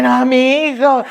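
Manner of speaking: slow, worried cry of my son; a mother's sudden fright, drawn out; alarmed mother. Retold or imitated. Mother hearing her son is sick, alarmed.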